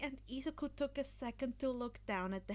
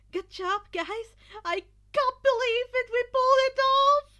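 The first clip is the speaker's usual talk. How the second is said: much higher, louder joyful shout; overjoyed; 12 semitones higher, 15 dB louder than usual. Acted. Izuku celebrating with his team, overjoyed. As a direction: burst with disbelieving joy. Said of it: "Izuku said to his team".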